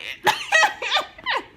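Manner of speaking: a sudden high burst of laughter; loud laugh. Real, not acted. Viewer laughs at Max lying, a loud high laugh.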